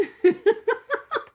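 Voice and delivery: a high, delighted laugh bursting out; high burst of laughter. Real, not acted. Teacher laughing at a student's chat comment, high and bright.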